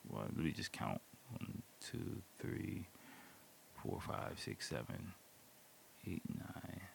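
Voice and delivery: very soft, whispery self-count; counting under the breath. Real, not acted. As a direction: count quietly to yourself, barely voiced. Counting windows to himself.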